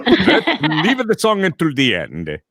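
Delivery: loud, high, slow Italian protest; drawn-out, hammed-up Italian accent; Italian singer accent. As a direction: plead like a mock Italian opera star. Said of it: "as an Italian singer".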